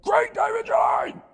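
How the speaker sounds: high shrill yell of a full name; mum's scream. Retold or imitated. Mum screaming at her boy near the road, high yell. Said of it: "Mum yells at me, like, screams at me".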